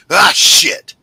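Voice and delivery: loud high sneeze-swear; imitating dad's sneeze that ends in ah, shit; sneeze into a curse. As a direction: blurt it out like a sneeze. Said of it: "he literally went".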